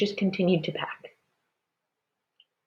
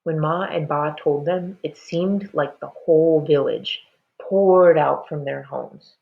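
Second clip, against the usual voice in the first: plain reading voice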